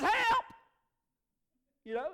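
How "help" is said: very high, loud yell; hammed-up desperate shout; acted cry for help. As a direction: scream it like someone drowning. Drowning Peter cries for help.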